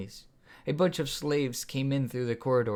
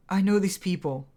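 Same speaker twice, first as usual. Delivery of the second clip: higher and louder, pleading; cried out; 8 semitones higher, 6 dB louder than usual. A narrator's reading. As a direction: call out to stop the killing. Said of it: "Sunflower cried out".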